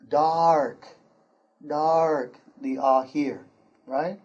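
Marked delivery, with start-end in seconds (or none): 0.1-2.4 'Dark' with an open 'ah' vowel; model ah sound. Shown as a demonstration. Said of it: "this has more of an ah sound".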